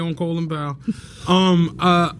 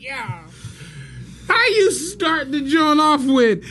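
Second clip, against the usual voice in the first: incredulous yell; 6 semitones higher than usual. Retold or imitated. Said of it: "I'm like".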